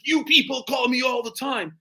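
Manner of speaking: mock an irate prospect bristling at the caller; angry prospect. Irate law-firm prospect snapping at a cold caller, indignant. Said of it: "he gets angry".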